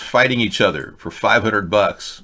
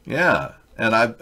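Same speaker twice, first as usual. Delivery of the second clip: usual speaking voice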